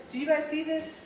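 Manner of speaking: an Italian driver's exclamation, lightly put on; Italian accent. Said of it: "I don't know if I can do the accent".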